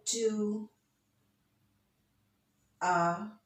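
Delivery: Shall I compare thee to a summer's day said one slow word at a time with long gaps. Shown as a demonstration. Teacher splitting the sonnet's first line into beats. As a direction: lay each word out separately. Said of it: "the first line".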